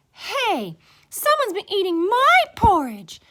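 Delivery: high, loud, indignant she-bear; Mama Bear. A narrator's reading. Mama Bear finding her porridge eaten, high loud voice. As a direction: cry out high and offended. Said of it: "Mama Bear looked at hers".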